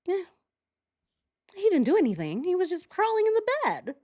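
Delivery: high mock shrug brushing it off; mock brass dismissing the assault; dismissive Corps voice. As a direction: sneer it away like it was nothing. Mocking the Corps' dismissive view.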